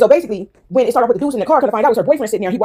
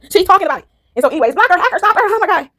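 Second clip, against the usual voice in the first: mocking voice; 9 semitones higher than usual. Mocking reaction to what others post.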